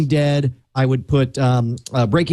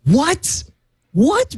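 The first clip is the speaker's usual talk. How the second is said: surprised; 11 semitones higher than usual. Real, not acted. Friend disputes his TV ranking.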